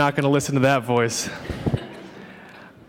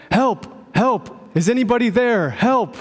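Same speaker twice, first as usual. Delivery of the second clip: high frantic cries for help; panicked yell; 7 semitones higher than usual. Retold or imitated. Hiker dangling from a branch, shouting panicked for help. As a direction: cry out for help, higher and higher. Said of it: "Panicked, he yelled".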